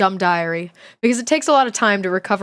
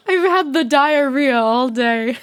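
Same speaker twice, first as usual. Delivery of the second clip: grandma voice through laughter; 2 semitones higher, slower than usual. Retold or imitated. Repeating grandma's words to chat while laughing. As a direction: try to do grandma but crack up laughing. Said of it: "She always calls it the diarrhea".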